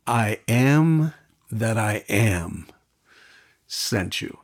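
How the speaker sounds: slow, solemn divine pronouncement; very slow, weighty declaration; solemn voice of God. Retold or imitated. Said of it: "And God says".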